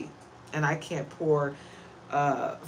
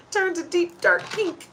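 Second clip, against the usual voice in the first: higher, laughing through the words; 14 semitones higher, 5 dB louder than usual. Real, not acted. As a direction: giggle at yourself, slow then bright. Laughs at own emotional red nose.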